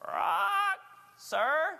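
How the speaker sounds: high squawky but now polite parrot. Acted. Beaten parrot greeting the man, high meek squawk. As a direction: squawk it meekly like a beaten bird. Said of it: "He goes".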